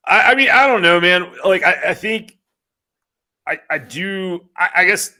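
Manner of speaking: excited. Real, not acted. Excited defence of his bracket pick.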